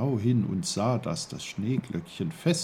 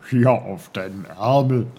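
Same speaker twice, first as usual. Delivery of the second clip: loud, put-on character voice; emphatic little uncle voice; Onkel Pfefferkorn; 10 dB louder than usual. A narrator's reading. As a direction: announce yourself with gusto. Uncle reveals himself.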